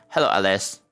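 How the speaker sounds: ordinary talk, nothing marked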